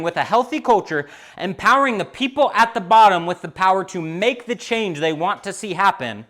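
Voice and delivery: loud, high, driving sermon voice; preaching at full force. Acted. Pastor preaching hard to his congregation, loud and high. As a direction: preach it out to the whole room.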